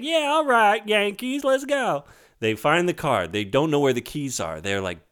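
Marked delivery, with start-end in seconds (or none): 0.0-2.1 put-on high, eager little boy voice; kid voice. Retelling the movie, voicing Billy as an eager high kid. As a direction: squeak it out like an excited kid. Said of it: "Billy's like".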